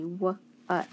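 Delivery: "w-" then "ut", with a short uh; sounding out. Shown as a demonstration. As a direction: break off the W, then say UT. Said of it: "Remember it says uh".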